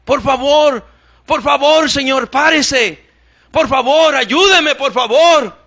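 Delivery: high, loud frantic pleas for help; desperate pleading. Retold or imitated. Woman begging drivers on the street, frantic and loud. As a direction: beg for help like it's life or death. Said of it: "gritando, desesperada".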